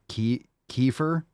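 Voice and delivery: "kefir" said KEE-fer, rising like a question; "kefir" with a long ee first syllable; alternate pronunciation. Shown as a demonstration. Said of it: "You pronounce it".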